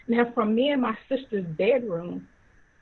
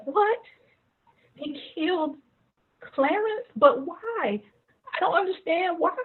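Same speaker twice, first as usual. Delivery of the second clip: higher-pitched, stunned questioning; saddened disbelief; 7 semitones higher than usual. Retold or imitated. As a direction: take in terrible news with raised, hurt questions. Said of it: "She sounded sad".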